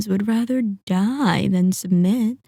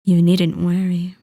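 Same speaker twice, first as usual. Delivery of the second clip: ordinary delivery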